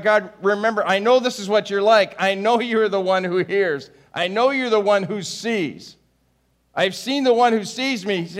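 high, loud pleading prayer; desperate cry. Acted. Acted desperate cry to God.

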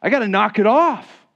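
mimicking a churchgoer telling himself to toughen up; mock churchgoer. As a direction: play a tough-it-out believer. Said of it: "We think".

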